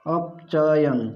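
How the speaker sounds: अपचयन stretched out very slowly, syllable by syllable; teacher slowly drawing out अपचयन for the class; slow, drawn-out term. Shown as a demonstration. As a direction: draw out अपचयन slowly so students catch the word.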